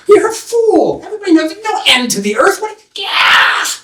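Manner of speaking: high, scornful jeering shout; mocking villager. Villagers jeering at the young man, high mocking shout. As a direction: laugh him off as a fool.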